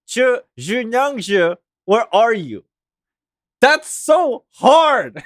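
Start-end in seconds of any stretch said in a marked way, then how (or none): 0.1-2.6 careful, effortful Chinese-style "Junyangzi", then the question; attempted Chinese pronunciation. Shown as a demonstration. Trying their best to say it proper, Chinese-style "Junyangzi". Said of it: "I'm trying my best here".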